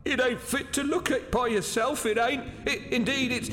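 very high, faltering warning; falter, then jump into a startled cry; rising fright. Acted. Waiter warning gentleman, hears a noise, terrified.

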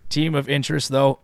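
rough, raspy throat; smoker's rasp. Real, not acted. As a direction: voice scratchy after clearing throat. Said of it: "I sound like I've been smoking for forty years".

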